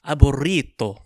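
Aburrito with a loud rolled r, t for d. Shown as a demonstration. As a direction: roll the r hard in Aburrito. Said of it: "I'll try to mimic Derbez on this one".

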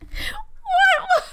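laughing. Real, not acted. Laughing disbelief at the voice.

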